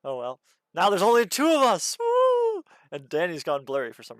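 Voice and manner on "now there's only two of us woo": high, loud gleeful whoop; excited cheer. Real, not acted. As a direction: cheer like you've won. Cheering two left.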